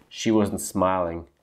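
ordinary delivery, nothing marked

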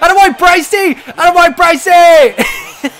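loud, high outburst on the edge of laughter; worked-up, laughing holler; excited yell. Real, not acted. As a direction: yell it at your goalie, cracking up. Laughing outburst at goalie during gameplay.

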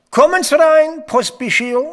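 high, loud call through the door. Real, not acted. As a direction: summon the captain from the next room. Colonel calls his aide inside.

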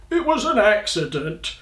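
high, protesting dragon voice; wounded dragon's outcry; roared protest. A narrator's reading. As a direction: roar it defensively as a dragon. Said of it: "he roared".

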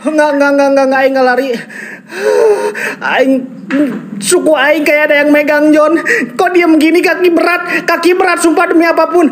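high-pitched, frightened pleading; real panic. Real, not acted. Ghost hunter feels his leg being gripped, high panicked voice. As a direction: protest in panic, pitch held high throughout.

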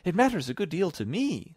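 high-pitched quick objection; urgent high reply; hasty protest. A narrator's reading. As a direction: object at once in a girlish voice. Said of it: "said Alice hastily".

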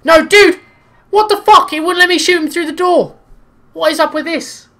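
high, loud furious shouting; outraged yell. Real, not acted. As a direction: rage at the game. Killed through door, outraged.

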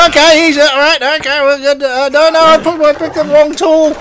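high, loud panicky squeals; flustered cry as the wolf comes; panicked yelp. Real, not acted. Wolf attacks, player panics.

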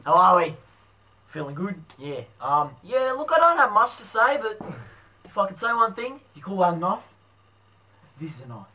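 put-on broad Australian bloke; bogan accent. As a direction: play the outback mate. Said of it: "we're going Bogan".

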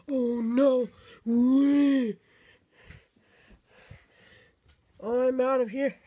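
loud, drawn-out hollering; panicked fleeing. Pretend. Player running from the killer, play-panicked shouting. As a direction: shout it while making an escape.

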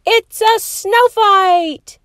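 high, slow, loud group shout; gleeful yell. A narrator's reading. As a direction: shout it together with glee. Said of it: "everybody yelled".

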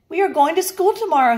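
high, fast and eager; excited announcement. Acted. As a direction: blurt the news out brightly. Farmer announces school to excited pigs.

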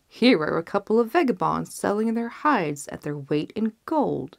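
usual speaking voice

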